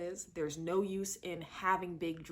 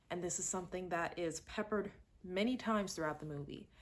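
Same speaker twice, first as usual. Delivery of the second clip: usual speaking voice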